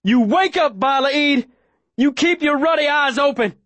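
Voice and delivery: high, fierce insistent yell; shouting at her. A narrator's reading. Boy at the face of the fading girl, shouting. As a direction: shout to keep her conscious. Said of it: "I shout at her face".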